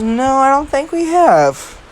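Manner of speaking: a silly high-pitched slow Deku line he apologises for; stupid voice. Acted. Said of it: "excuse my stupid ass voice there".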